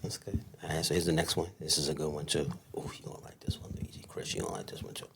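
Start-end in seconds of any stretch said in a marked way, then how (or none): 2.8-4.0 a playful hushed aside; teasing whisper. Real, not acted. Whispered aside before question. 4.1-5.1 a playful hushed aside; teasing whisper. Real, not acted.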